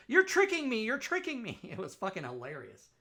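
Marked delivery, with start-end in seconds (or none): 0.1-1.6 very high, very loud whiny protest; mock dupe. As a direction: shriek it like a volunteer who thinks he's being conned. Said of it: "And they go".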